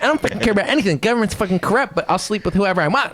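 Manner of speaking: high, brash mock rant; mock degenerate libertarian. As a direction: ham up a reckless rebel. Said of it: "It's like".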